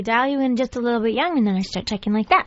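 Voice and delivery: slightly younger, put-on kid voice. Shown as a demonstration. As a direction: take the voice a notch younger.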